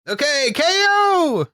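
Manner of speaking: very high, slow exclaimed title; mock yell. Shown as a demonstration. Echoing co-host's title cry, mock yell. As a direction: holler the K O without real volume. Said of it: "you gotta yell the, the K O part".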